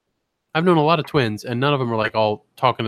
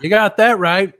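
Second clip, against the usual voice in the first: higher, punchy imitated old man; old man's catchphrase; 7 semitones higher, 5 dB louder than usual. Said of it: "Two takes".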